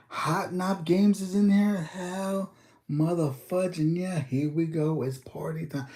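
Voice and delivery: high, hyped welcome to a viewer; excited greeting. Real, not acted. Streamer hyping up a viewer who just joined, excited high voice. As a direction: pitch it way up and hype the arrival.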